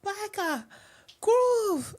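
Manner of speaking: hammed-up Biker Grove accent, high on the words; show-off bad regional accent. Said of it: "I can't do accents, it's so bad".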